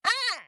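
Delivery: high-pitched gasp into "ah"; shocked gasp. Retold or imitated. Teller's shocked reaction to freakout.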